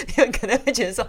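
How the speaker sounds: 笑着高声说; 话里带着笑声; 边笑边说. Real, not acted. 讲到风水边笑边说. (笑着说话)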